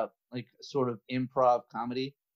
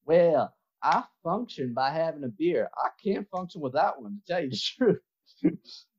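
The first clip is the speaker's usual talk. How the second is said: Southern guy. Acted. Southern drinker answering his worried friend, Southern accent. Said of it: "you're the Southern guy".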